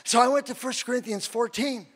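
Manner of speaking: usual speaking voice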